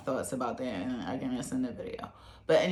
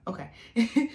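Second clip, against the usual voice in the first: amused okay; 9 semitones higher, 5 dB louder than usual. Real, not acted. Laughing at own slip.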